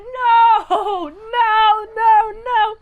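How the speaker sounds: loud, high hammed-up cry of despair; horrified wail. Rocky learns he is in hell, acted horrified wailing. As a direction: wail like a doomed man, mock horror.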